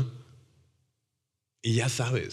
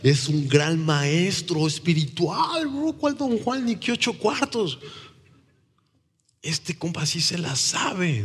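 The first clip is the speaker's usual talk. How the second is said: higher, slow, thrilled retelling of his own discovery; excited amazement; 6 semitones higher than usual. Retold or imitated. Recalling finding Jesus, he gushes with high excitement. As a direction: gush like a new convert. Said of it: "Y yo dije".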